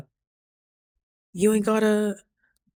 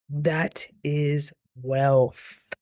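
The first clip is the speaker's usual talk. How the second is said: very slow, each word stretched; slow, weighty repeat, word by word; drawn-out emphasis; much slower than usual. Retold or imitated. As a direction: draw out WEALTH with a long held ending. Drags out the repeated line.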